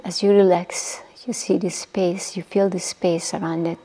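guided meditation. Acted. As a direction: ease the listeners down into stillness, breathy and unhurried. Leads a guided body-relaxation meditation.

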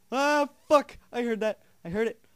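high, loud real scream of fright; startled yell. Real, not acted. Player who heard something in the game, startled yell. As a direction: yell in shock, then stay high and loud.

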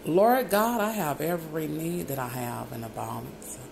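ordinary delivery

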